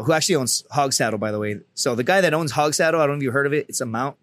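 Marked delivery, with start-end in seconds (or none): none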